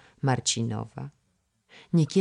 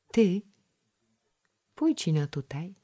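put-on a small shy girl's voice, pitched up; timid little girl; 5 semitones higher than usual. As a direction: call him over shyly like a little girl. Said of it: "zaszemrał nieśmiały głosik". (nieśmiały dziewczęcy głosik)

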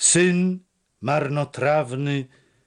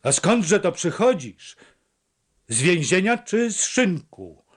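stern, accusing, pitched higher; cold rebuke of a shamed son; 7 semitones higher, 4 dB louder than usual. A narrator's reading. Mother questions her returning son, stern and accusing. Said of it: "spytała surowo".

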